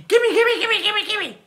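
very high, grabby chimp chatter; greedy chimpanzee. Acted. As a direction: demand it like a greedy little chimp.

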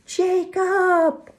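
loud, high, long-held call; piercingly high shouted name; crying out. A narrator's reading. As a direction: call out the name desperately into the distance. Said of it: "I cried again".

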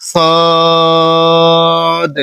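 সদ drawn out with a final bounce; correct Arabic letter. Shown as a demonstration. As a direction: stretch সদ long and end with a push. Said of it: "আমি আবারও বলে দিচ্ছি". (সদ টেনে শেষে বাড়ি দিয়ে)

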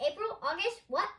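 high, loud, rising questions; startled and confused; baffled surprise. A narrator's reading. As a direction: echo each word in disbelief. Doctor baffled by the news.

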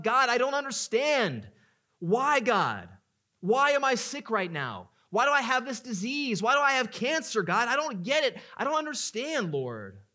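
loud anguished cry, pitch high. Acted. Preacher voicing a sufferer crying out to God, loud anguish. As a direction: shout your questions up to God. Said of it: "Cry out".

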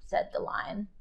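soft, breathy narration tag; whisper. A narrator's reading. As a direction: let it fall to a whisper. Narration dropped to a hush.

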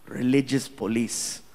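ordinary talk

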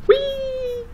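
high squealing whee; gleeful whee. Retold or imitated. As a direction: squeal it like flying off a cliff. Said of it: "ten seconds later it went".

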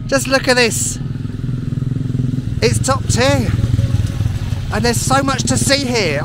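bursting with excitement, loud and high; real thrill at the view; excited. Real, not acted. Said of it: "out of breath with excitement".

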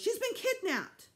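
high, loud, drawn-out wail; panicked mother's cry; distressed cry. A narrator's reading. Mother fearing baby was taken, distressed cry. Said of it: "Mom cried".

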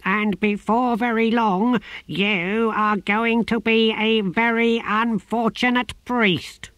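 the tortoise god menacing the novice. A narrator's reading. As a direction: promise doom. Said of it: "in a menacing and unavoidably low voice".

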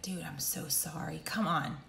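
half-whispered, soft commiseration; soft, low-key consoling murmur; hushed sympathy. Retold or imitated. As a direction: imitate a parent soothing a hurt kid under the breath. Parent soothing fallen child, retold.

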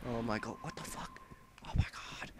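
whispered disbelief; stunned whisper; shaken whisper. Real, not acted. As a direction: breathe it out, shaken. After scare.

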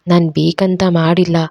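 ordinary delivery

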